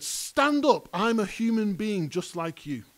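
firm, emphatic order from a scripture reading; commanding. Acted. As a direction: call it out high and forcefully. Peter's command read aloud from scripture.

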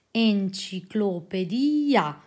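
"Enciclopedia" drawn out syllable by syllable; "Enciclopedia" very slow and deliberate; slow correction. Acted. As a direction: spell it out slowly, as if to a child. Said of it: "sussurrò lentamente il segretario".

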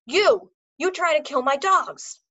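loud, high furious yell; furious shout. A narrator's reading. Dog trainer accusing the foreman, furious shout. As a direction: scream the accusation in a rage.

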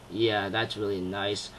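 soft, slow, low musing under the breath; inner thought. Retold or imitated. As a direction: think it quietly to yourself, slow. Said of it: "he's thinking about mine".

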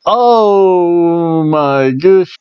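hugely drawn-out mock astonishment; exaggerated amazement. Vlogger reacting to Sasha Banks coming out, drawn-out amazement. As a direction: stretch it slowly in hammed-up shock. Mock shock at wrestler's looks.

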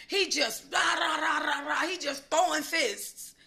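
excited blow-by-blow; mock punching frenzy. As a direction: act out the flurry of fists. Acting out the punches.

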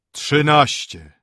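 slow, loud booming toll; bell calling out. A narrator's reading. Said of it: "zawołał nagle".

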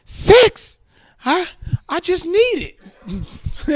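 high, sheepish squeak; blurted confession. Retold or imitated. Man confesses to church counselor, high squeak.

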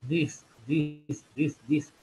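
"this" with teeth pressed together, short clear i. Shown as a demonstration. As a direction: say "this" as if chewing, teeth touching. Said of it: "pégalos, así como si estuvieras masticando algo". ("this" con los dientes pegados, la i corta)

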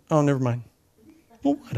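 normal talking voice

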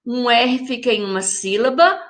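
ordinary delivery

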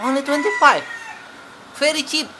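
high, loud, amazed at the price; delighted surprise. Real, not acted. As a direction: gasp it out, thrilled at the bargain.

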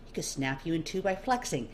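plain reading voice

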